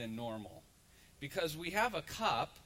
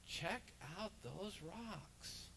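tempting devil; 3 semitones higher, 9 dB quieter, much slower than usual. Retold or imitated. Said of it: "the devil appears to Jesus and he says".

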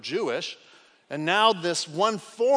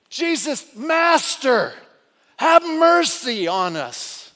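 shouted, higher pleading cry; lifted voices; 7 semitones higher, 9 dB louder, slower than usual. Retold or imitated. Lepers crying to Jesus, loud plea. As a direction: shout for mercy across the distance. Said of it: "They were lifting their voices".

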